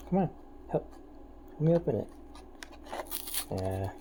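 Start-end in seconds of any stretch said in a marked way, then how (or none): 0.1-2.1 coaxing pet voice. Real, not acted. Coaxing cat again.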